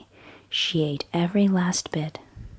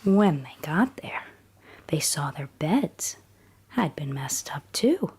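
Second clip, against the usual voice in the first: whispered bedtime story. A narrator's reading. Storyteller reading Goldilocks at bedtime, in a hushed whisper. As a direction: whisper the tale as if a child is drifting off.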